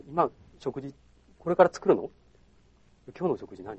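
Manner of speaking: calm, low questioning voice. Retold or imitated. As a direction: ask it low and soft. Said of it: "こういう声で喋ると". (低く落ち着いた声)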